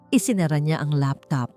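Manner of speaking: usual speaking voice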